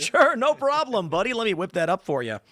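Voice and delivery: mock-cheery robot sounding too human; perfect human robot. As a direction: gush it like a cheerful robot waiter. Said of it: "robot's gonna sound like a perfect human".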